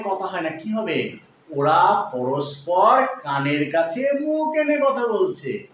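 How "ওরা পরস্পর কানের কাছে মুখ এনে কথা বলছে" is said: slow, clear dictation. Shown as a demonstration. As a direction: spell out the correct sentence slowly, word by word. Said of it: "কি বললাম?". (ধীরে ধীরে স্পষ্ট করে বলা)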